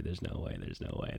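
"There's no way" breathed out, whispered; whispered refusal. Acted. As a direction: hush it as if refusing to yourself. Muttering refusal under his breath.

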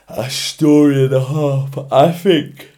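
words stretched by a yawn. Real, not acted. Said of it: "pardon me, I'm yawning".

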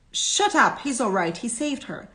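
loud, high rebuke; angry bystander. A narrator's reading. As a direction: cut someone off sharply. Bystander silencing a lynch call.

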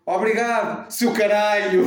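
high, loud sarcastic thanks; sarcastic insult. Retold or imitated. Imita a mãe a insultar. (mãe a insultar o condutor, mais alto e agudo)

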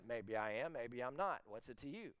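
low, soft, noncommittal reply; evasive answer. Acted. John refusing a straight answer, low and evasive. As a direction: drop the voice and let it fall away.

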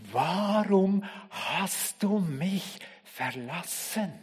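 "du" drawn out, very slow and soft; soft lament. Acted. Preacher voicing Jesus asking why he is forsaken, slow soft lament. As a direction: ask it slowly, broken. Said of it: "Hier schreit er".

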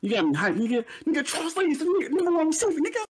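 hyped-up, high-pitched praise. Real, not acted. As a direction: shoot the voice way up, all fired up.

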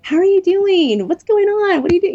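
very high, effusive imitation of a greeter; imitating an over-friendly acquaintance; friendly lady's greeting. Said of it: "a lady walked up to me after the meeting and said".

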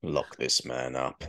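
low, slow stern judge. Pretend. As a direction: pronounce it like a verdict. Mock judge.

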